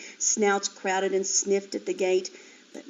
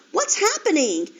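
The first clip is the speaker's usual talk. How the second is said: high, loud frightened question; frightened piglet crying out; panicked piglet; 7 semitones higher, 4 dB louder than usual. A narrator's reading. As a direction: ask in alarm. Flora sees mother tied.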